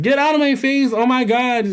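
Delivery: high-pitched mock indignation; upset woman, imitated. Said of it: "why she was so upset".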